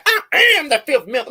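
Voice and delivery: quick high laughing mock; mock her through laughter; laughing mimic.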